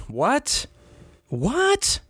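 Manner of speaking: squeaky high double take on what; mock disbelief. Host hears [name] news, mock squeaks what. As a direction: fake shock, voice jumping way up.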